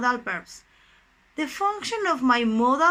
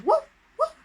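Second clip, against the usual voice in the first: short high-pitched woofs; dog bark imitation; 14 semitones higher than usual. Shown as a demonstration. Teacher shows students what barks means, high imitated woofs. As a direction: imitate a little dog barking. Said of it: "What is barks?".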